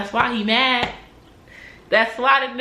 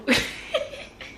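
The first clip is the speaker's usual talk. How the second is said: soft laugh; 4 dB quieter than usual. Real, not acted. Laughing after mockery.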